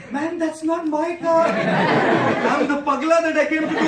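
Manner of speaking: slow, loud, laughing through the words; stretched-out laughing plea; laughing mock protest. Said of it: "I wanted to put the windshield down and say".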